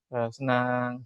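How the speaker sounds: low, quiet, joyless answer; unenthusiastic yes. Acted. Child answering whether they like studying, unenthusiastic. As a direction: mumble it like a child who isn't happy at all. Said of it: "tapi ekspresinya nggak senang".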